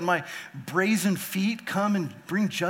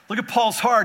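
loud, higher call to look at his heart; urgent exhortation; 6 semitones higher, 7 dB louder than usual. Real, not acted. Preacher to his congregation, raised and urgent. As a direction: press the point hard.

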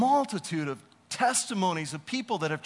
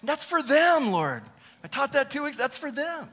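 higher, defensive excuse to God; mock protest; 6 semitones higher than usual. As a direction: push back like a caught-out teacher. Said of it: "It's like".

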